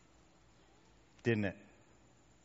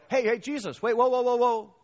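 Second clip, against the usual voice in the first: raised, high voice calling across a gap; 9 semitones higher, 6 dB louder than usual. Acted. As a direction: call after someone walking away. Calls to Jesus across a distance.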